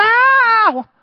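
sudden high, loud scream; piercing mock outburst; acted scream. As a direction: burst out screaming mid-service. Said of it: "go, somebody goes".

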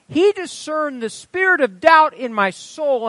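high, loud pulpit reading; full-force preaching narration. A narrator's reading. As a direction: proclaim the testimony with force. Reads testimony at full force.

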